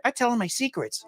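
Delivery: lightly voiced spooky kid; creepy child voice. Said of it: "kids are just like".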